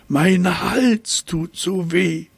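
high, slow, whiny complaint; whining giraffe. Acted. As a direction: moan it like a sore-throated giraffe. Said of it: "jammerte sie". (hohe, langsame Jammerstimme der Giraffe)

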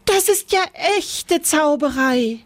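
high, delighted squeal; joyful cry. A narrator's reading. Lisbeth gets her rocket broom, joyful cry. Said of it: "schrie vor Freude auf".

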